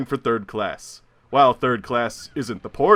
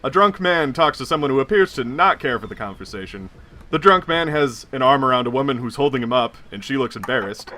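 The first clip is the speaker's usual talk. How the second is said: ordinary delivery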